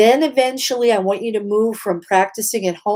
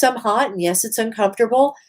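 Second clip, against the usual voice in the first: ordinary talk, nothing marked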